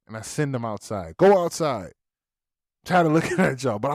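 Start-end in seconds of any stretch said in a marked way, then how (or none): 1.2-3.8 irate order. Retold or imitated. Dad sending son out, irate.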